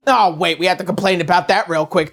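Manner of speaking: normal talking voice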